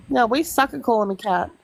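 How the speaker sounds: usual speaking voice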